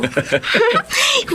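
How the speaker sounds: high, bright giggle of delight; happy girlish laugh. Acted. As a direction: laugh with pleased surprise at the food. Delighted by favorite breakfast.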